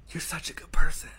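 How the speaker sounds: breathy whisper into an ear. Retold or imitated. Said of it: "he whispers in my ear".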